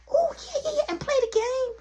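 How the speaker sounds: put-on high, loud childish glee; squealed, eager child voice; excited little boy. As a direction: imitate a kid suddenly delighted.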